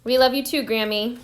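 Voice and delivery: ordinary delivery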